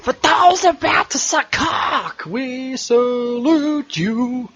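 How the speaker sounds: high, slow rock-singer wail; rock anthem voice. Pretend. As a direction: belt it like a stadium frontman. Rock anthem parody sign-off.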